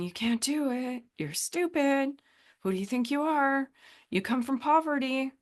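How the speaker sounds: a hint of a sneering voice in the head; negative monkey mind. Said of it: "No negative monkey mind going".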